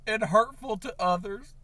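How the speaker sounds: ordinary talk, nothing marked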